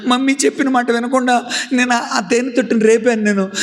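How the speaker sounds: put-on high, sobbing child voice; tearful confession. Reena confessing to neighbours, tearful. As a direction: confess through tears.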